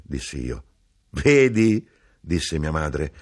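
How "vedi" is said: a louder, pleased "Vedi?"; triumphant. A narrator's reading. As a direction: crow over the small victory. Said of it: "ed era trionfante". (trionfante, soddisfatta)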